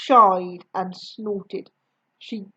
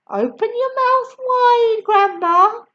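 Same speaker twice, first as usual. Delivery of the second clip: put-on higher, louder boy's voice; George coaxing; 11 semitones higher, 4 dB louder than usual. A narrator's reading. George urging Grandma to take the medicine, eager higher voice. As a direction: coax Grandma like an eager boy. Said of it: "he said".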